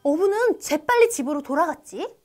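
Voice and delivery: ordinary delivery, nothing marked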